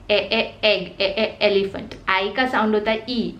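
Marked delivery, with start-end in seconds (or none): 0.1-1.9 ए as a short eh, repeated twice, then एग and एलिफेंट; crisp phonics drill, ए, ए, एग; short e sound. Shown as a demonstration. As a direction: sound out ए, ए clearly like a teacher. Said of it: "ई का sound होता है ए".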